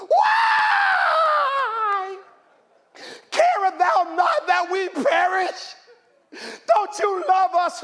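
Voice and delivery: high desperate wail; anguished shouting plea; disciples crying out. Acted. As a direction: cry out in panic to the Master.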